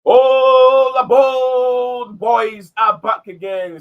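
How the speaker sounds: high, slow, booming train-conductor call; all aboard call. Real, not acted. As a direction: belt it out like a conductor. Host's opening call on return.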